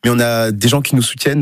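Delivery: normal talking voice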